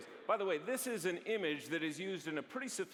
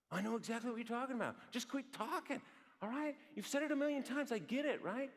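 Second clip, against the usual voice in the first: higher-pitched, exasperated comeback; impatient retort; 6 semitones higher than usual. Acted. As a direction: cut them off like an irritated spouse. Acts out an impatient partner cutting in.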